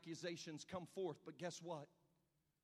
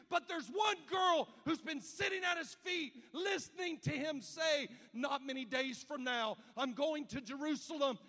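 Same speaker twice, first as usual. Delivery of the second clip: loud, high, impassioned preaching shout; preacher at full cry, voice raised and straining; full-force preaching; 13 semitones higher, 13 dB louder than usual. Acted. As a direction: climb to a roaring pulpit peak.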